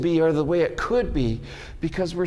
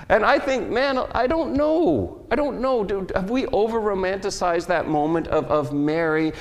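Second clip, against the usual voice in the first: ordinary talk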